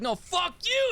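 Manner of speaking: high, shouted retort; hammed-up internet fight shout; mock shout. Retold troll back-and-forth.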